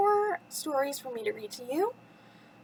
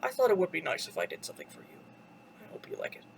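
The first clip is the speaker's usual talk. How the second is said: low, quick monster voice fading to a whisper; low creature voice, trailing into whisper; Beast; 7 semitones lower, faster than usual. A narrator's reading. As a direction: explain it low, then hush right down. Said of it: "the Beast explained".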